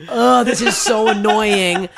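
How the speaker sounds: exaggerated annoyance. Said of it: "I'm like".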